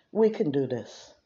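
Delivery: normal talking voice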